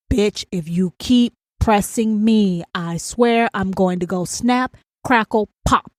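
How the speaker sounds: slow, word-by-word warning; inner threat. Acted. Ranter's head warns someone who keeps pressing, slow threat. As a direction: space out each word with controlled menace. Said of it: "Now, my head is saying".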